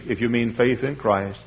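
ordinary talk

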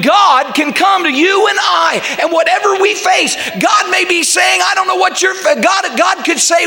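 high-pitched, urgent preacher delivery; impassioned preaching. Real, not acted. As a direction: preach it high, driving every phrase home.

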